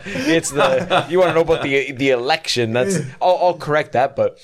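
high and loud, laughing through the words; laughing disbelief. Retold or imitated. Teacher answering a student's funny mistake, laughing high and loud. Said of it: "I was like".

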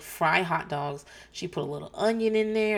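ordinary delivery

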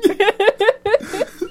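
cracking up in a high giggle; kind gentle laugh. Real, not acted. Cracks up at friend's message.

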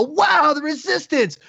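rally the troops like a kids' cartoon, way up high; mock heroic Resistance. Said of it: "the Resistance will show up and go".

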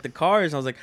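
ordinary talk

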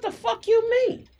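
high-pitched mock indignation; retold angry challenge. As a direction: imitate an incredulous friend demanding an answer. Acted quote, friend's angry challenge.

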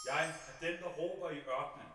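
very soft, barely carrying; inaudible preaching. Acted. Priest quoting John with the microphone off, too quiet to hear. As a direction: say it as if the microphone has died. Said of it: "Vi kan ikke høre, hvad de siger".

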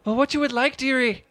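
very high, loud put-on dearie voice; character voice on request. Game master voices a server on request, high put-on voice. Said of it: "Do the voice".

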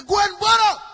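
high, full-force shout; emphatic shout. Acted. Preacher ordering the congregation to obey the prophet, emphatic shout. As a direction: belt it out as a command, drawn to the end. Preacher urges the instruction loudly.

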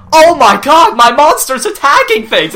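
loud, high-pitched hammed-up alarm; mock panic. Imitating the monster's owner under attack, mock panic. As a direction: cry out in exaggerated shock. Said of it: "he's like".

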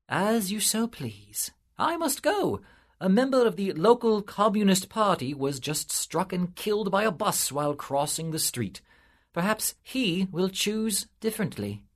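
a lightly voiced lofty angel; angelic invitation. A narrator's reading. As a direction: offer heaven with grand patience. Angel takes leave.

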